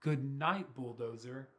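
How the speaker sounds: ordinary delivery, nothing marked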